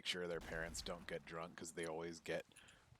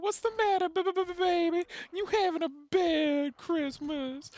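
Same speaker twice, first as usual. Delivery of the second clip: taunting clown; 14 dB louder than usual. Retold or imitated. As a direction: needle him like a sleazy clown. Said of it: "And the clown's like".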